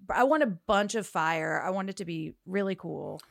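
ordinary talk, nothing marked

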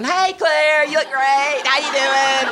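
high, loud, over-bright fake smile in the voice; hammed-up phony delight; fake cheery greeting. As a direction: gush a greeting with put-on cheer. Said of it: "I started faking smiling".

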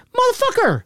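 very high indignant curse; retold exasperation. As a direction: squeal the curse in mock outrage. Retold exasperation, aunt knew all along.

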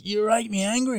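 high-pitched mock-angry accusation; angry boy. Boy blaming the workshop leader, mock angry. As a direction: imitate a worked-up lad, pitched well up. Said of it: "he was all angry".

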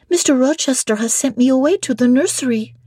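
put-on high, light child voice; small girl pitched high; little French girl Adele. A narrator's reading. Adele answers Jane.

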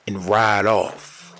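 usual speaking voice

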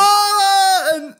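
excited. Real, not acted. Reacts after the result.

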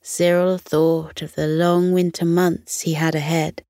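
plain reading voice

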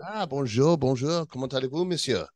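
mock French greeting; French accent. Greeting the French correspondent, in a hammed-up French accent. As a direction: ham up a French accent, pitched a little high.

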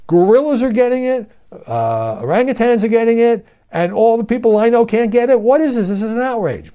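high-pitched, loud, incredulous protest; mock outrage. Host acting out own reaction to zoo vaccines, mock outrage. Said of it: "my reaction to this was".

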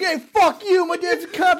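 high, laughing shriek of defiance; mock shriek. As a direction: yell back at the creep like a bratty teen. Mocking the girls' retort.

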